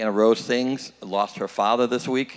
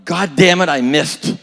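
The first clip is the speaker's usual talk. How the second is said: loud, higher outburst of frustration; exasperated golfer's shout; sudden yell; 5 semitones higher, 8 dB louder than usual. Acted. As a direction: yell it out suddenly, like a botched golf shot. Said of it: "all of a sudden yelled".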